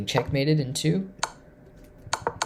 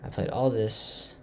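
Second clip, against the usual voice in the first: ordinary talk, nothing marked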